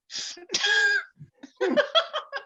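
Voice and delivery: high, helpless burst of laughter. Real, not acted. Reader laughing at her own filthy story, real laughter. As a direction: crack up at the line you just read.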